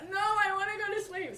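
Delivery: put-on high, childish whine; whiny refusal. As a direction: refuse like a sleepy kid. Baby starts pushing.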